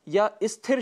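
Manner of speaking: स्थिर said as "isthir"; mispronunciation with added i. Shown as a demonstration. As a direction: put a short i before स्थिर. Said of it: "या स्थिर शब्द का प्रयोग करते हैं". (स्थिर को इस्थिर कहना, अशुद्ध उच्चारण)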